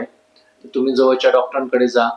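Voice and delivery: ordinary talk, nothing marked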